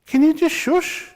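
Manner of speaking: hint of an MP begging the Prime Minister; imitating a pleading MP. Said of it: "He goes".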